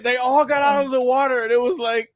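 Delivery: ordinary talk, nothing marked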